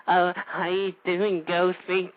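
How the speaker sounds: soft, slow, simpering mock caller voice; mocking effeminate caller. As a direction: sneer at the caller by mimicking him softly and slowly. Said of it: "You, you sound like a goddamn fruity ass".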